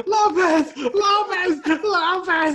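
high, loud hammed-up cries of a name; acted anguished holler; frantic calling out. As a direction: yell the name in mock desperation. Frantic calling at burning house.

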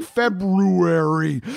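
"February" slowly sounded out syllable by syllable. Shown as a demonstration. Speaker showing how she sounds out February, slow. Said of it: "words that I have to sound out".

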